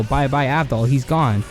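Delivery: ordinary talk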